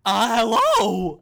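loud, high, slow cartoon king; King Candy. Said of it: "This is King Candy!".

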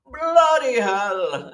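drawn-out, loud, high English exclamation; English father-in-law. Retold or imitated. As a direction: bellow it slowly in an imitated English accent. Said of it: "and he always says".

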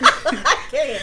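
loud laughter through the words; can't stop laughing. Real, not acted. Still cracking up at the show joke, laughing loud.

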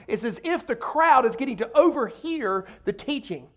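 ordinary talk, nothing marked

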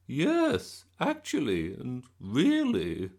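high, loud gushing granny; excited Gran voice. A narrator's reading. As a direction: bubble over with glee.

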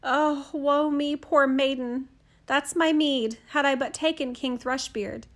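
lament. Acted. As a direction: bewail your fate like a ruined princess. Princess laments again.

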